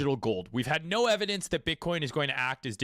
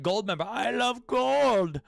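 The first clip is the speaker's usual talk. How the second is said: gloating tweet; 6 semitones higher than usual. Retold or imitated. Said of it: "he's like".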